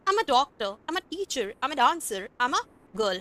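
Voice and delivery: I'm said as a short "am" with no "I" sound. Shown as a demonstration. Teacher shows students how I'm should sound. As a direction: clip I'm down to "am". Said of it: "हमें am बोलना है".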